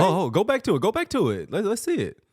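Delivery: high and eager, rewind that; urgently excited, let's see it. Real, not acted. Eager to rewatch play.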